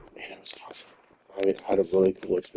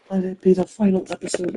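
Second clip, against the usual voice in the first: exasperated demand; 11 semitones higher, 4 dB louder than usual. Real, not acted. Frustrated demand about finale.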